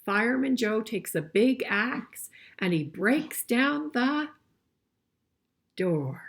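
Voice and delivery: storybook reading voice; story reading. A narrator's reading. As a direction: read it out clearly for the class. Reads the picture book aloud.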